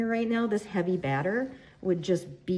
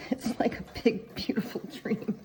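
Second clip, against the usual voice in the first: laughing; 5 semitones higher than usual. Real, not acted. Laughing after joke.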